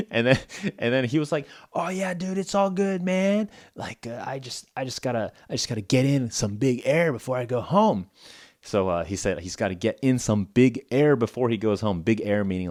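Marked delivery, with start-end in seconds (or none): 1.7-8.1 a chill California bro who only wants big air; mock California surfer dude, high and relaxed; California dude accent. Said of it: "super classic California accent".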